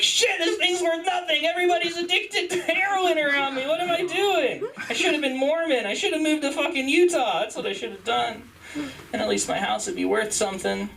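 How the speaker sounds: high, strained comic lament; mock despair. Welfare house owner regretting West Virginia, high mock despair. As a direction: wail with exaggerated regret, easing at the end. Acted regret of disappointed homeowner.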